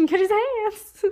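high, drawn-out silly voice; slow high-pitched mock threat; playful echoed taunt. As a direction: echo it way up high, stretched and laughing. Echoes the joke, laughing, high.

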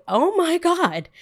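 high, drawn-out exasperated exclamation; acted dismay. Barista watching customer throw things, drawn-out dismay. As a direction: stretch it out in appalled disbelief.

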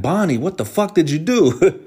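high, loud, shocked demand; shocked grandma. Retold or imitated. Grandma opening the car door, loud and shocked. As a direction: call out in alarm like a startled grandma. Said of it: "she's like".

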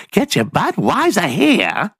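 high mock hawker calling out; beer vendor call. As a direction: sing it out like a stadium vendor. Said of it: "walk around and go".

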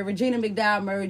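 normal talking voice

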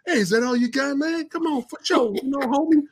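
mock dubbed tough guy from the South; Steven Seagal. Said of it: "you would hear Steven Seagal go".

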